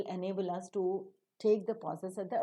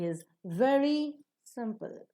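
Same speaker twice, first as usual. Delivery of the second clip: "very" held up, then "simple" dropping; fall on simple; 3 semitones higher, slower than usual. Shown as a demonstration. Teacher shows the nucleus, falling on "simple". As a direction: step down firmly onto "simple". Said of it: "Fall down".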